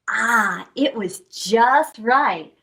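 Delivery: ordinary delivery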